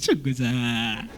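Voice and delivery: deep slow voice. Acted. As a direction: drag it out, low and heavy. Seller voiced, Levantine line.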